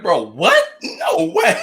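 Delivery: loud, high disbelief; shocked. Retold or imitated. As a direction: blurt it out in disbelief. Shocked retold reaction.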